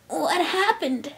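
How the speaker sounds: high, slow, weakened voice; frail, uncertain high voice; shaky question. A narrator's reading. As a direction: ask shakily, drawing it out. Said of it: "the Cyan Pegasus asked shakily".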